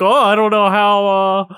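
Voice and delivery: mock-innocent winner's disbelief; feigned surprise. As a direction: act lightly amazed at a rigged win.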